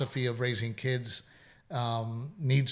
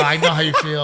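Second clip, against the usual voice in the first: says it louder while laughing; 7 dB louder than usual. Retold or imitated. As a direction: laugh through a mock reply to the toddler. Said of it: "I go".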